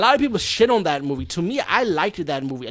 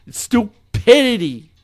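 Stupidity stretched out slow, louder and higher; disgusted emphasis; 7 semitones higher, 9 dB louder, much slower than usual. Real, not acted. Reviewer condemning the plot, Stupidity said slow, loud and high. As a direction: spit out the word Stupidity slowly.

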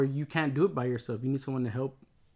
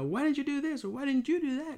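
mock accusing; 11 semitones higher than usual. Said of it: "heated argument".

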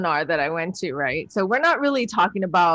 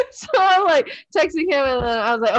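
ordinary talk

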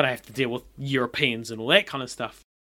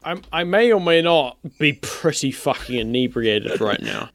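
ordinary talk

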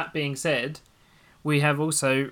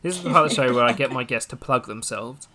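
louder, punchier show intro; presenter voice; 8 dB louder than usual. Shown as a demonstration. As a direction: announce it like a host. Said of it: "I was gonna say it again. It's really bad".

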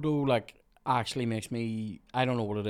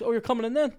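high, louder taunting question; mimicked manager; 11 semitones higher, 5 dB louder, faster than usual. Worker retelling the manager answering his callback, mocking high voice. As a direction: imitate the boss, pitched well up.

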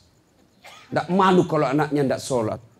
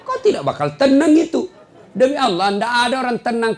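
laughing exclaim; 9 semitones higher, 6 dB louder than usual. Real, not acted. Preacher laughs loudly.

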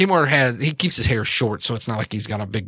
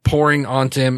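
ordinary talk, nothing marked

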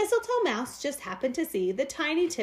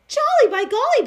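much higher and louder gleeful exclamation; jolly whoop; 13 semitones higher, 10 dB louder than usual. A narrator's reading. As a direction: kick up your heels and whoop it. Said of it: "Barnaby said with a kick".

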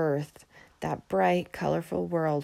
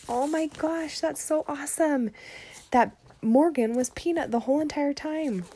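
high, gushing amazement; thrilled reaction to the twist; squealing with delight at the reveal; excited; 9 semitones higher than usual. Real, not acted. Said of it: "teacher gushing after story".

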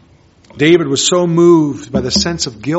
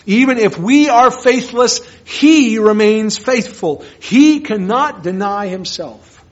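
loud, higher, slow scripture proclamation; full-force preaching; 6 semitones higher, 7 dB louder than usual. Acted. Preacher quoting Paul to the congregation, loud and slow. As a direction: thunder the verse out to the congregation.

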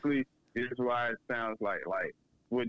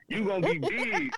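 [name] sound bite; 8 semitones higher than usual. Retold or imitated. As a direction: punch it up like a rapper's tag. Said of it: "He made his sound bite like".

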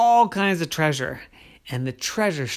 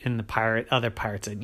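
plain reading voice

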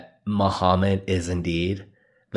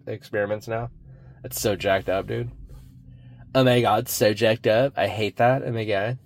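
ordinary talk, nothing marked

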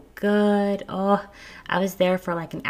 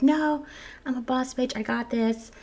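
cocky bravado, pitched higher; mock prideful; 6 semitones higher than usual. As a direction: play the tough one in jest. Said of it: "trying to be all prideful".

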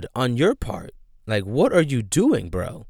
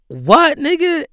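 high, loud stunned outburst; can't believe what he just heard; shocked disbelief; 5 dB louder than usual. Real, not acted. Ranting to camera about [name], loud stunned disbelief.

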